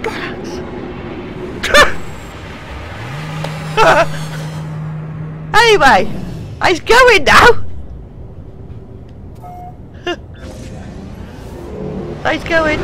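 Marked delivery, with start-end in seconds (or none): 0.6-5.5 long airy chuckle; breathy laugh. Real, not acted. Laughing after crash. 7.5-12.3 airy falling giggle; high breathy laugh. Real, not acted. Laughing at plane leaving.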